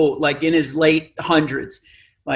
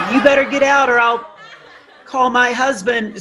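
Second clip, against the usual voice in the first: mock tough warning; 6 semitones higher than usual. Shown as a demonstration. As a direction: threaten the burglar, badly. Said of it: "only guy that can stand at the top of the stairs and go".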